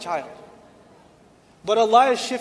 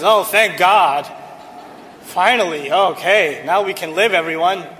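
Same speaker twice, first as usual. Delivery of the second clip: drip fake gratitude at her husband; wife sarcastically welcoming her late husband home; sarcastic wife; 4 semitones lower than usual. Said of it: "Why do you have to be sarcastic".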